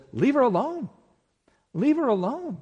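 high, emphatic command; firm rebuke. Acted. Jesus defending Mary against Judas, firm rebuke. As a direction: step in protectively, pitch lifted on each command.

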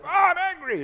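high, loud, hammed-up flare of anger; mock irritable outburst. The irritable person over nothing, mock anger. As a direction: snap like an inflamed nerve.

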